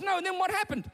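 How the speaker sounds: alarmed father. Acted. Father hearing daughter's story, high-pitched mock alarm. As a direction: demand the next detail as a worried father. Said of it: "Mike goes".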